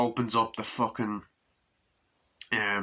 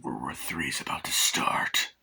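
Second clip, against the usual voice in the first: whisper; 7 semitones lower than usual. Real, not acted. Whispered aside about a noise.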